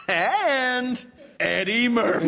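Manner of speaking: slow, high, drawn-out trailer announcer; trailer voice finale. Retold or imitated. Guest mimics the trailer's last credit, slow high announcer voice. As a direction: stretch it out like the big trailer reveal. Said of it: "It's always like".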